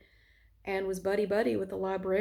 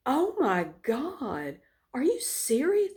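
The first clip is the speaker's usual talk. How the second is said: slow, stunned, rising to a high squeak; shocked disbelief; 3 semitones higher, slower than usual. Retold or imitated. Principal hearing the story, stunned and high-pitched. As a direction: jaw dropped in disbelief. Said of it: "her jaw just hanging open like".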